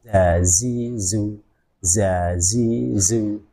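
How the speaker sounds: Arabic letter za drilled syllable by syllable. Shown as a demonstration. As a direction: sound out "za, zi, zu" as a teacher. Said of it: "Jadi makhrajnya itu sama dengan sin".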